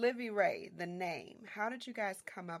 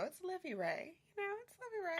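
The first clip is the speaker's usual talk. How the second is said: playful delight; 11 semitones higher, 3 dB quieter, faster than usual. Real, not acted. Savoring the name playfully.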